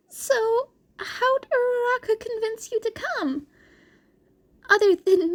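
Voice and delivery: put-on high, anxious teenage boy; awkward nervous laugh then hurry; nervous Izuku voice. A narrator's reading. Izuku covering his nerves with Shinso, high and anxious.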